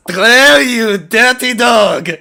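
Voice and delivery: spring up and call him out; triumphant shout. Acted. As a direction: Eddie leaps up and yells at his captor. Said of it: "and goes".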